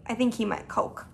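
ordinary delivery

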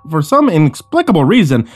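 plain reading voice

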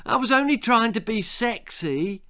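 lightly voiced defensive character; apologetic boy. A narrator's reading. Spike's excuse, voiced by narrator.

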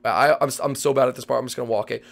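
ordinary delivery

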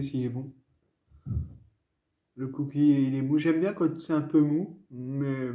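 words muffled by food; talking while biting cookie. Real, not acted. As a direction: mouth pressed on cookie. Seen 1: Cookie held against lips, biting it, through most labelled words.

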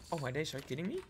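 normal talking voice

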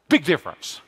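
ordinary talk

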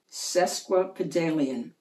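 ordinary delivery, nothing marked

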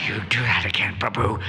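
low mock-menacing warning; wrong-mood threat. Devotee threatening the one called master, low mock menace. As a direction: threaten under cover of a respectful title. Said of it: "not always with the right mood".

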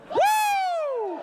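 high, loud party whoop. Real, not acted. Comic opens his set with a drunken whoop.

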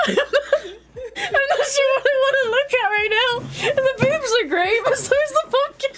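high, squeaky voice full of laughter; laughing hard. Real, not acted. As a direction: barely get words out through giggles. Cracking up at the costume.

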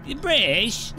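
exaggerated British pub-goer; put-on British accent. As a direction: play up a cartoon British accent. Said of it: "like, that accent".

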